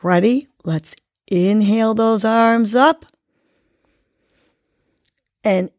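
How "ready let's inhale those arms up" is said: normal talking voice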